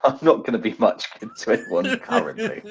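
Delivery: ordinary talk, nothing marked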